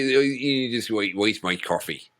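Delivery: a stab at a New York accent that slips into Irish; botched New York accent; Irish accent attempt. Shown as a demonstration. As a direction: put on a 1920s New York voice, landing Irish. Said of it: "that's Irish".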